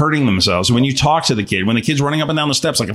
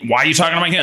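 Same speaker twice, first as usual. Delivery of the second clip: normal talking voice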